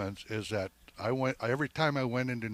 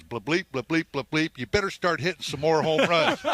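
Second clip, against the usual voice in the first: louder mock cussing-out, higher; growing louder and higher as he bawls out the young player; Mickey Mantle chewing him out; 6 semitones higher, 7 dB louder than usual. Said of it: "And he goes".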